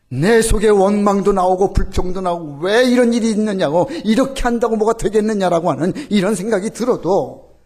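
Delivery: full-force sermon delivery; fervent preaching. Acted.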